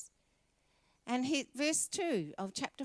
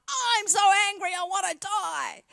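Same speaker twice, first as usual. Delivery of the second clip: petulant, much higher and louder cry of anger; sulky Jonah; 9 semitones higher, 9 dB louder than usual. Acted. Jonah answering God, petulant angry whine. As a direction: whine like a furious sulking prophet. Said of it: "It's just like".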